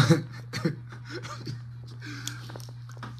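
real high breathless laughter; high squealing laugh. Real, not acted. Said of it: "me I try hard for no laugh".

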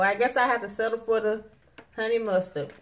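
usual speaking voice

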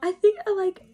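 put-on high, small child's voice; a little girl confused by her tears; young tearful self. Young [name] answering dad, high and childlike.

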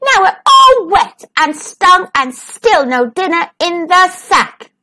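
high, wailing grumble; mildly howled lament; howled complaint. A narrator's reading. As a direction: wail it like stung cubs. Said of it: "howled little Wolf and Smells".

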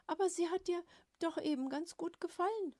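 put-on high, cheeky little girl's voice; innocently needling her father from a safe distance. A narrator's reading. Bixi teases father from distance. (hohe, unschuldig-freche Mädchenstimme)

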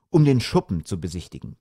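ordinary delivery, nothing marked

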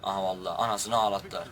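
ordinary talk

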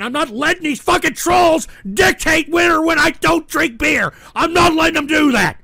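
high, loud indignant yelling; worked-up angry protest; defiant shout. Real, not acted. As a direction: yell your refusal at the trolls. Defying trolls about beer.

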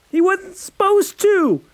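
high, loud desperate outburst; boy blurting his defense in a panic; blurted shout. Acted. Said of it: "Alex blurted".

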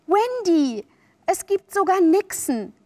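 high, eager revelation; thrilled and coaxing; excited enticement. A narrator's reading. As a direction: share a marvel to tempt them. Peter tempts with mermaids.

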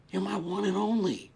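usual speaking voice

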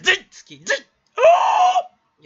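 high strangled shrieks breaking into a long howl; weird voice. Acted. Streamer overdoes fandom for a duo, weird shrieking. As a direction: squawk it loud and high. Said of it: "変な声出すの".

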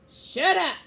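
high, loud command; angry roar. A narrator's reading. Captain Bones silencing his men, loud roar. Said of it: "Captain Bones roared at his men".

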